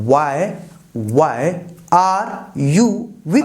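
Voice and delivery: slow, spaced words, "weeping" drawn out; teacher reading out the question; slow dictation. Shown as a demonstration. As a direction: dictate it slowly for the class. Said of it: "teacher dictates, slow end".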